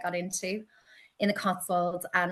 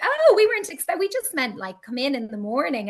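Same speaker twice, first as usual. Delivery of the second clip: surprised shop worker; 6 semitones higher, 6 dB louder than usual. Retold or imitated. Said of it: "And they were like".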